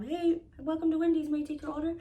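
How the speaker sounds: light impression of an intercom greeting; drive-thru greeter. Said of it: "lady starts speaking on the intercom".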